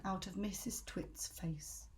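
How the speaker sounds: whisper. A narrator's reading. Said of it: "reading aloud".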